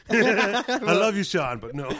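loud, slow laughing "I love you"; gently turning him down; fond rejection. Retold or imitated. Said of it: "I'd have been like".

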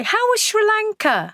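high, bright sing-song question; a friend eagerly asking after a trip; chirpy friend. Retold or imitated. Imitating a friendly acquaintance asking about her trip, high and chirpy.

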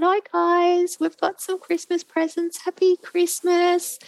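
high, singsong Christmas hello; cheery greeting to children. Retold or imitated. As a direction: act out greeting little grandkids brightly. Retold greeting to the grandchildren.

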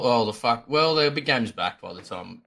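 ordinary delivery

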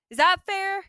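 indignant retold question; mock courtroom plea. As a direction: put the question up high and loud.

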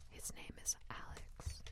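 fast breathy whisper; ASMR whisper. Shown as a demonstration. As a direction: whisper close to the mic. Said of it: "Let's make an A S M R video".

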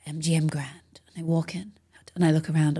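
whispered, hushed and awed. Retold or imitated. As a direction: drop to a whisper as you walk in. Hushed memory of walking onstage.

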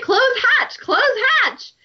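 high, commanding hammy order; high mock command; bossy Thorn. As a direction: shout orders at a stubborn hatch. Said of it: "So he's like".

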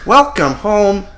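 high, loud put-on robot voice, slowing down; high mock-robot greeting, words drawn out; pretending to be a robot. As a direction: badly fake being a robot. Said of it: "the girl has to pretend".

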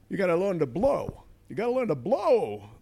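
high, loud, pushy little pig; Rodney Dangerfield pig voice. Retold or imitated. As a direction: coach him to blow like a wisecracking pig. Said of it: "who I modelled on Rodney Dangerfield".

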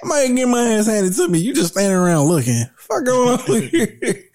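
high comic whine through laughter; mock plea. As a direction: plead for help while cracking up. Replaying the beaten man's plea, laughing.

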